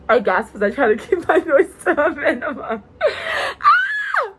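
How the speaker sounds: laughing, rising to a high loud yelp; laughing gasp. Real, not acted. Reader breaks down laughing over the scene, ending in a yelp. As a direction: crack up and squeal.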